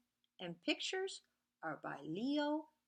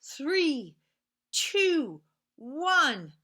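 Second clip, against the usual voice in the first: excited countdown; 8 semitones higher, 14 dB louder, slower than usual. Acted. Reading to kids, rocket countdown building to a loud launch. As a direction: build up loud to a shouted blast off.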